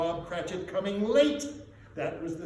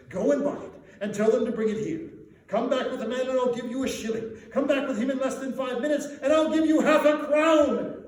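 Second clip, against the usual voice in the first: loud, high, quick instructions; eager urgent orders; 7 semitones higher, 8 dB louder, faster than usual. Acted. Scrooge sending the boy off for the turkey, eager and quick. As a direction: hurry him off in a joyful rush.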